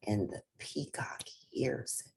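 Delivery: hushed storyteller whisper. A narrator's reading. Narrator confiding to young listeners in a whisper. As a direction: whisper it like a secret. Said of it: "narrator drops to a whisper".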